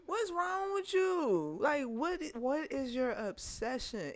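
high, slow disbelieving what's wrong; drawn-out incredulous question; exasperated. Real, not acted. Venting to a friend about her ex, high and incredulous. Ranting about ex's obsession.

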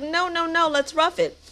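lightly acted, high-pitched overruling of a complaint; imitated dad's protest.

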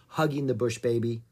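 ordinary delivery

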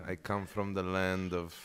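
slow, soft, dreamy pitch; seductive sales pitch. Retold or imitated. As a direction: lay on the exotic charm slowly. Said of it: "are you selling them the Mexican story".

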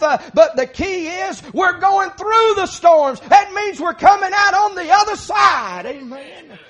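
high, loud pulpit delivery; fiery preaching. Acted. Preacher urging folks through the storms, loud fiery preaching. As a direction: drive it home at full preaching force.

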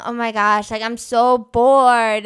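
retold chat message with an exaggerated, elongated "bored"; drawn-out bored. Recalling teenage Gmail chat messages, drawn-out bored. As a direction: stretch BORED out long and loud. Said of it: "probably with, like, five million, like, E's".

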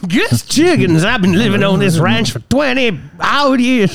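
hammed-up old-timer voice; Gus Chiggins. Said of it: "That's what they sound like".

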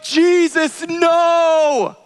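high, drawn-out plea to heaven; stretched, high-pitched desperate cry; crying out in retelling. Retold or imitated. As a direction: re-enact crying out to God in dismay. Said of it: "crying out to him".

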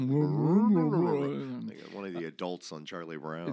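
muffled mouth-full mumble; Charlie Brown adult voice. Shown as a demonstration. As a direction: talk like a muffled trombone adult. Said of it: "it'll sound like this".

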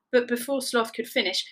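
plain reading voice

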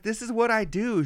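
lightly mocked female caregiver, high; reassuring nurse. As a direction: pitch it up to soothe, as a kindly carer. Nurse reassuring him, retold.

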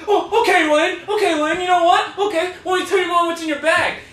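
high, whiny mock outburst; imitating crying Brett. Friend imitates Brett crying and threatening Lane, high and frantic. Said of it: "just starts crying".

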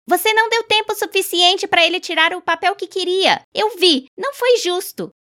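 grito indignado, muito agudo e alto; berro. A narrator's reading. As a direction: proteste com raiva contra a injustiça. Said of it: "Tessie Hutchinson berrou". (Tessie berra para o senhor Summers, indignada)

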